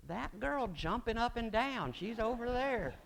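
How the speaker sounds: high-pitched imitated excitement; acted excited pointing-out. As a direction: raise the pitch as if spotting someone across a lot. Acted excited spotting of the girlfriend.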